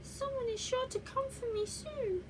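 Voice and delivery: high hopeful little bear voice; teddy bear voice. Acted. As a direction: squeak it like a small lonely toy. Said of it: "Growly Bear may have said to himself".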